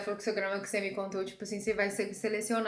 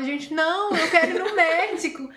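high, louder insistence with a laugh; laughing mock protest; 8 semitones higher, 5 dB louder, slower than usual. Sick woman insisting to people on seeing a doctor, mock protest. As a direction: protest in raised pitch, amused at yourself.

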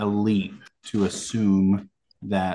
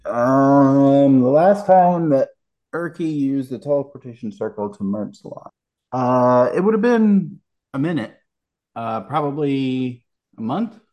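a hint of a higher character voice; lightly voiced character; 5 semitones higher, 7 dB louder than usual. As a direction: NPC speaking up. Game master voicing NPC Urky.

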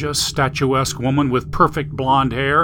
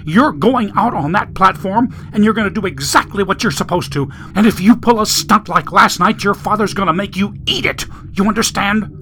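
higher, forceful threatening tirade; angry scolding; 6 semitones higher than usual. A narrator's reading. Mother ordering her son onto the platform, angrily scolding. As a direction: build from a threat into hard, high-pitched scolding.

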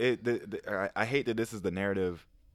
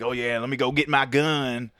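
slow, louder Texan-style drawl; Southern drawl; 3 semitones higher, 6 dB louder than usual. Retold or imitated. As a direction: imitate a slow Southern US accent. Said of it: "Yeah, Southern drawl".

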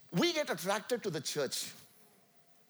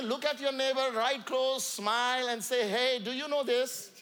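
fervent preacher address; rally the congregation; preaching; 4 semitones higher than usual. Acted.

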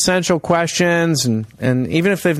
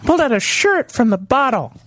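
much higher, hammed-up club comedian delivery; mock stand-up comic; 9 semitones higher than usual. Host telling sunscreen bit to imagined crowd, hammed-up comic. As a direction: work the crowd like a cheesy comic.

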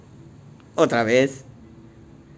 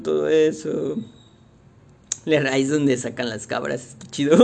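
normal talking voice

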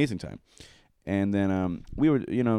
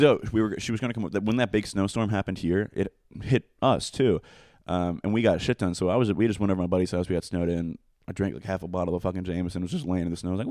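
ordinary talk, nothing marked

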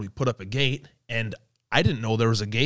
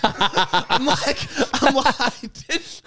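laughing; 11 semitones higher, much slower than usual. Real, not acted. Laughing as he starts retelling his reply.

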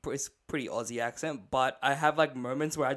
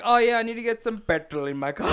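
put-on Aussie English lapsing into a raised, louder Sinhalese accent; hammed-up Sinhalese-accented slip, higher and louder; slipping into Sinhalese accent; 8 semitones higher, 6 dB louder than usual. Joking about their own accent lapses, mock Sinhalese slip. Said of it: "default back to like, Sinhalese".

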